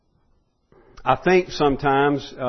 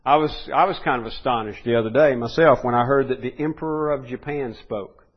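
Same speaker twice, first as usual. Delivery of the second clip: ordinary talk, nothing marked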